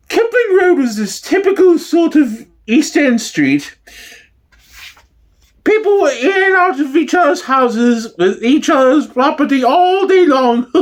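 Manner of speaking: high falsetto gossiping housewife; East End housewife. Acted. Neighbour reminisces to the interviewer in a high falsetto housewife voice.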